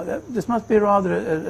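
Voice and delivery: ordinary talk, nothing marked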